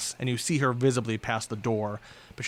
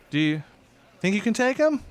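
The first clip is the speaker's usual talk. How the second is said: high, louder call down the hall; calling villainess; 10 semitones higher, 5 dB louder than usual. Acted. Henchwoman calling to her partner, high and louder. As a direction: shout a question to a fellow viper. Viper calls across the hall.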